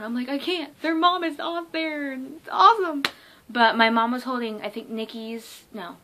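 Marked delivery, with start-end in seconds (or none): 0.3-3.0 high-pitched, can't-calm-down gushing; excited freak-out. Retold or imitated. Fan told by mom to calm down, high excited gushing. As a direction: act out being too thrilled to calm down. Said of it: "freaking out".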